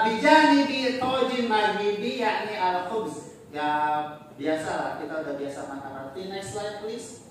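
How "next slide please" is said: normal talking voice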